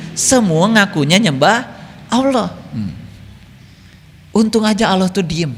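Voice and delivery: ordinary talk, nothing marked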